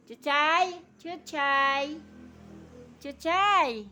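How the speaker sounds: loud, high pet call. Real, not acted. Calling out a pet's name repeatedly.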